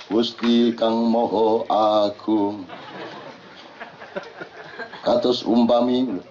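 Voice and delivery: lambat, bergaya dalang wayang; kaya wayang. Retold or imitated. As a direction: ucapkan seperti dalang membuka lakon. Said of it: "kaya wayang". (gaya dalang wayang yang lambat)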